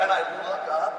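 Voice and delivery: usual speaking voice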